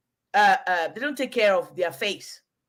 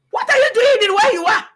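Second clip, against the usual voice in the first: high, loud outraged yell; loud incredulous scolding; shocked shouting; 11 semitones higher, 8 dB louder than usual. Retold or imitated. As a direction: shout in disbelief at his hard palm. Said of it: "The way I screamed".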